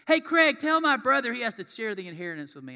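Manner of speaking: loud, high holler at the preacher; yelling out. Acted. Churchgoer hollering at the pastor right before the sermon, yelling out. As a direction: holler it across the room as an interruption. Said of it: "somebody yells that out".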